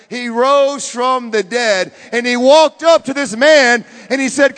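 very loud, slow proclamation; full-force preaching. Real, not acted. Preacher proclaiming the resurrection, full force.

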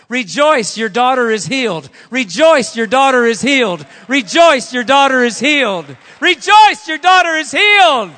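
loud, high-pitched, insistent shout; booming proclamation. Acted. Evangelist's message to the weeping mother, shouted in triumph. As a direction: proclaim it at full force, climbing with each repeat. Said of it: "Here's what the tongue said in that dialect".

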